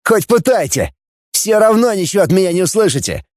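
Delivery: high-pitched defiant taunt; near-shout without real volume; almost shouting. A narrator's reading. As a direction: defy your captors, worked up. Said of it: "почти кричал Фанас".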